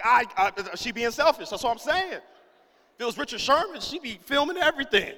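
hammed-up exasperated complaint; mock indignation. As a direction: whine loudly about being wronged. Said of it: "me and Ira mad at Devin".

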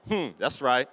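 low, self-satisfied salty hum. Retold or imitated. Husband refusing to show wife he's glad, salty. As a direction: play at still being sore, pitched low. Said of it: "I'm so salty still".

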